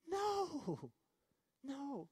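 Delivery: high, soft, long-drawn no; drawn-out mock dismay. Preacher answering a mistaken believer, high soft dismay. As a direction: croon a long, high no, gently falling away.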